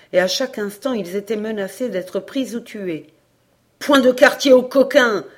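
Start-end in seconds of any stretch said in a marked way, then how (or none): 3.8-5.2 loud, high battle shout; loud, merciless rallying cry; fierce pursuer's cry. A narrator's reading. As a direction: shout it as the man leading the chase. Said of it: "s'écriait un des plus acharnés".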